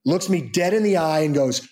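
ordinary delivery

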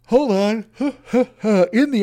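talking through a real yawn; high, stretched words swallowed by a yawn. Real, not acted. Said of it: "I'm yawning".

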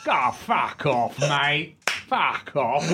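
slow, dismissive Cockney; imitated Cockney brush-off; Cockney stranger. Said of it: "this Cockney comes in".

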